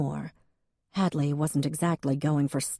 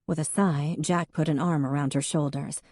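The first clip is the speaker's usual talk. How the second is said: ordinary delivery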